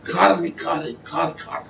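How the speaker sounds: kari kari then kar kar, unvoiced and soft; whispered Hebrew for cold, cold; Hebrew demo. Shown as a demonstration. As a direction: kar kar breathed out softly. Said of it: "I'd say "cold, cold".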